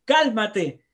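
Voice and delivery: imitating a parent shouting at a child; yelled command. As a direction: bark "Cálmate" like a frustrated father. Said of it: "le grita, le dice".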